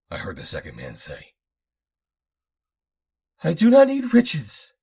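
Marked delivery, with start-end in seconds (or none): 3.4-4.7 put-on high, loud cackling old man; shrill-voiced mad scientist cackling; cackling old inventor. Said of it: "cackled Fortock".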